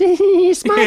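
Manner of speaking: very high, loud falsetto; hammed-up high pop-star voice. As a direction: squeal it like a giddy prankster. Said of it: "[name] voices are so fun".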